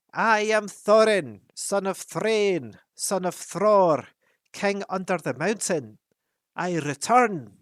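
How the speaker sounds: very loud, slow, high proclamation; loud cry. A narrator's reading. Thorin announcing himself at the feast, loud slow cry. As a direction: declare yourself to the whole hall. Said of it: "cried Thorin in a loud voice".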